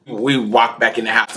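normal talking voice

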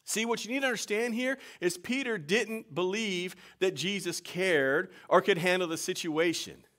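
loud, high, ringing sermon voice; full-force preaching. Acted. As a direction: preach it out to the whole church.

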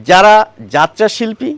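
usual speaking voice

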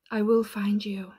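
a hammed-up threatening whisper; mock menacing whisper. Playfully threatening the anonymous sender, mock-sinister whisper. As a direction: tease them like a movie villain.

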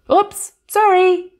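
high, loud sudden apology; startled yelp. Acted. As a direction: blurt it out, caught off guard. Door bangs, character startled.

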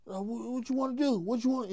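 high, hammed-up impression of a nervous tough guy; mock Cat voice. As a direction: pitch jumped way up, imitating a jittery little guy. Mimicking Cat confronting him.

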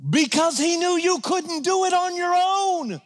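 high, slow, ringing plea; heartfelt preaching. Real, not acted. Pastor reminding the church they can't do it alone, heartfelt. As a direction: plead it from the heart. Emotional peak.

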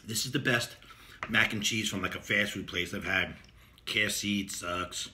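savoring between bites; talking while chewing. Real, not acted. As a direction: mouth partly full of food. Seen 1: Bites before and near 12.6 s; holds loaded spoon while talking.

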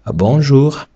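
"бонжур" pronounced as French bonjour; French reading. Shown as a demonstration. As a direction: say "бонжур" with French nasal vowel and French r. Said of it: "І слово читається".

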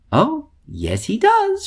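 high, lightly voiced smiling mother; a touch of a tender mother; smiling reassurance. A narrator's reading. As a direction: gently reassure the child.

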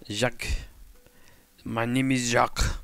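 put-on slow, lightly French-accented orc voice; introducing himself as a French orc, slowly. Builder plays a made-up French orc for his viewers. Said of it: "a French accent with an orc voice".

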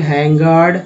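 ordinary delivery

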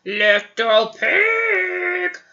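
slow, drawn-out wolf call. Acted. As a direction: growl it slowly like a wolf. Wolf voice in story.

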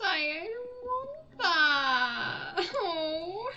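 very slow laughing whine into a shriek; laugh-crying. Real, not acted. Frustrated player laughing at her bad luck. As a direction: dissolve into laughter.

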